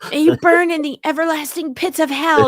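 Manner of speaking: high, solemn curse with sweet blessing; damn them then bless them; laying a curse. Pretend. Mock curse.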